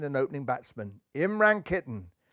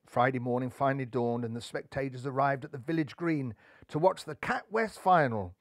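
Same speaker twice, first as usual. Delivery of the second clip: plain reading voice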